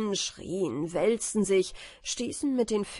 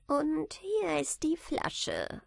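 high, slow little man's voice; smiling little man; 8 semitones higher, slower than usual. A narrator's reading. Little man handing Michael the bottle, smiling. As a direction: say it slowly and high, with a smile. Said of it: "sagte der Kleine und lächelte".

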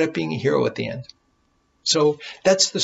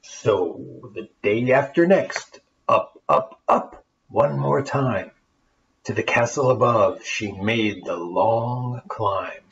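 lively rhyming storybook reading; story narration; 5 semitones lower than usual. A narrator's reading. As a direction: read it like a bedtime tale for kids. Reads the rhyming tale aloud.

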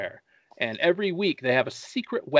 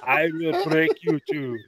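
lightly voiced Russian threat; a hint of Russian villain; Russian accent; 3 semitones higher than usual. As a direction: menace in a mock Russian accent. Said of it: "Great Russian accent".

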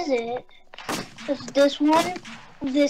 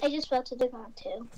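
whispering; 9 dB quieter than usual. Real, not acted. Unvoiced whisper in game.

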